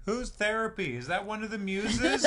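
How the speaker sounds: high-pitched confused heckler; puzzled cult member. Acted. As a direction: pipe up from the back, high and puzzled. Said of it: "Someone in the back is just like".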